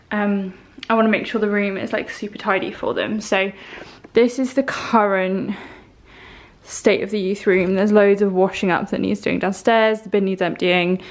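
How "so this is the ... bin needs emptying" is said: ordinary talk, nothing marked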